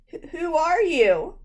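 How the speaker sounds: high, slow, halting fright; stammering. A narrator's reading. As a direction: stumble out the question scared. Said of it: "I stammered".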